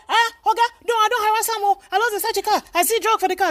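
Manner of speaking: high-pitched, hammed-up excuse-making; mock defensive protest. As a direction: imitate an officer protesting his innocence to the boss. Officer defends himself to his superior.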